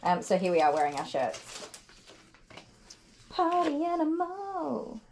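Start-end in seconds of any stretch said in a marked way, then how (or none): 3.3-5.0 drawn-out call. Real, not acted. Announcing the badge theme.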